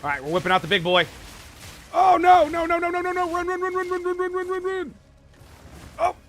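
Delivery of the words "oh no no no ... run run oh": panicked high yelling, fleeing; screaming run in pure terror; terrified horror victim. Real, not acted. Panics as huge enemy attacks.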